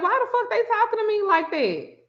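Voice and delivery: high-pitched, baffled complaint; indignant disbelief. Retold or imitated. Telling friend her reaction to producers' slang, indignant.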